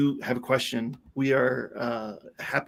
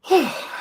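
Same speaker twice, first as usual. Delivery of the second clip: long breathy exhale, winded; big tired sigh. Real, not acted. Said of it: "catching my breath".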